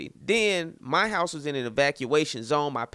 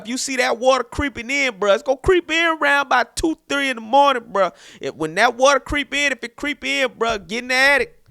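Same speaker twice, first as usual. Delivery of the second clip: higher, louder, urgent buddy voice; hammed-up urgent warning, pitched up; homeboy giving advice; 8 semitones higher, 5 dB louder than usual. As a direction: imitate an insistent friend laying out a survival plan. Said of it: "His homeboy, I guess".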